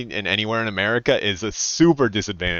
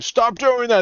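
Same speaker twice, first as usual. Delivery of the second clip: mock yell; 11 semitones higher, slower than usual. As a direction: yell at the kids to quit it.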